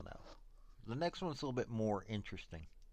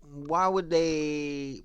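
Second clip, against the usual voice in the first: raised, louder questioning voice, drawn out; doubting listener; 2 semitones higher, 7 dB louder, much slower than usual. Acted. Host imagining a listener questioning the cover-up, doubtful. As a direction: voice a listener's doubt, stretching the words. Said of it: "you may be going".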